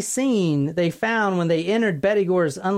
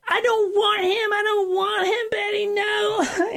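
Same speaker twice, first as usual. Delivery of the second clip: very high, wailing protest; imitated hysterical screaming; 12 semitones higher than usual. Patient in hypnotherapy pleading with victim, acted hysterical wail. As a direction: cry out in mock distress. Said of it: "And she was screaming".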